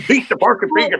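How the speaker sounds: mimicking a German speaker, high, fast and angry; mock angry German. Man imitating angry German people for the room, mock angry. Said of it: "they all sound angry".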